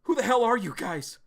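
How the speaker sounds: mock-confront the intruders; purple-haired guy challenging strangers; challenging demand. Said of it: "He's like".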